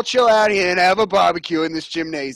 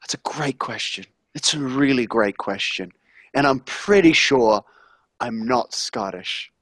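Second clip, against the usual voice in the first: low, hushed chef impression; Gordon Ramsay; 8 semitones lower than usual. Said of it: "It's Gordon Ramsay".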